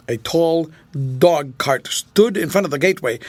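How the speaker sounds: ordinary delivery, nothing marked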